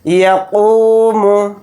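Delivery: یقوم with قو held long, یا and میم quick; short ی, long قو, short م; only قو drawn out; stretch the middle syllable. Shown as a demonstration. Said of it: "صرف اس میں قاف کو کھینچ کر پڑھیں گے".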